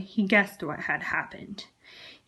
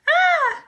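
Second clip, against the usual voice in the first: short, much higher, louder scream of fright; frightened shriek; 7 dB louder than usual. Acted. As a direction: yelp in sudden fright. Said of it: "jumped with fright".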